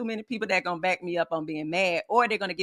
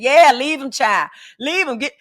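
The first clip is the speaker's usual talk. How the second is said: loud, higher mock friend urging her to leave; mock egging on; 5 semitones higher, 8 dB louder than usual. Host imitates a friend egging her to leave, loud and mocking. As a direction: ham up the bad friend cheering her on. Voicing a bad friend's advice.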